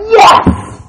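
shouted, high, breathless "Yes!"; joyful yes. Retold or imitated. Healed woman crying out with a joyful shout. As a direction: burst out in triumph. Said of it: "And she's like".

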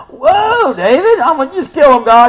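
high, loud exclaiming; mock shock. Preacher reacting to David's prayer for death, loud mock shock.

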